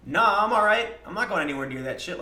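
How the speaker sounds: loud, high brush-off; loud nah, not joining in; loud refusal. Retold or imitated. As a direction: wave off the gang, loudly. Refusing to join the fight.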